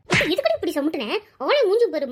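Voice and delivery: ordinary delivery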